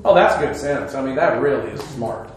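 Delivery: low, deadpan sarcastic aside; mock approval, dropped low and soft; dry sarcasm. Real, not acted. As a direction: say it drily, meaning the opposite. Mocking mobs that trash town.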